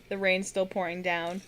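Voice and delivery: ordinary delivery, nothing marked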